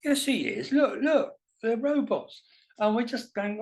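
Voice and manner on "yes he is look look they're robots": high, eager insisting; second lad arguing back, high and excited; excited young guy. Retold or imitated. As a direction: point and insist, pitched way up. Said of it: "the other one says".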